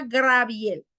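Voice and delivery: drawn-out "Graviel" for Gabriel; R transposed in name. Shown as a demonstration. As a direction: shift the r up front, "Graviel". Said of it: "transponer las".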